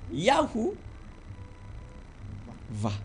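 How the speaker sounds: Yahoo, then a separate Va; split pronunciation. Shown as a demonstration. Teacher reading out the written Hebrew, split pronunciation. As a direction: say Yahoo and Va as two isolated pieces. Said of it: "That they wrote".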